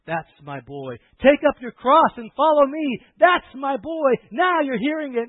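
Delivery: loud, high, fervent sermon cry; preaching at full force. Acted. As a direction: preach it out to the whole hall, fired up.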